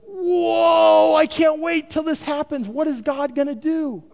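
an eager crowd member who can't wait; eager excitement. Retold or imitated. Creation voiced straining to see.

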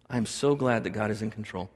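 big sigh, then low soft relief; relieved bedtime prayer. Acted. Tired parent on the pillow at night, relieved sigh.

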